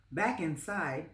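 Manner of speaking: plain reading voice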